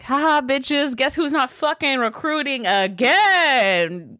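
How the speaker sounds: high, sing-out taunt, stretching the end; gloating. Retold or imitated. New orientation counselor to the recruiters, gleeful gloating. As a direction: crow it, drawing out the last word.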